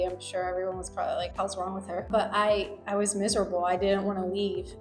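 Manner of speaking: normal talking voice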